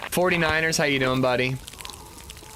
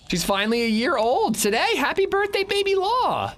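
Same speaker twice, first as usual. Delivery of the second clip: high sing-song. Acted. Birthday wish in sing-song voice.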